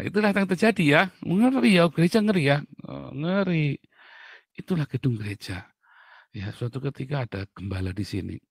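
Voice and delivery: ordinary talk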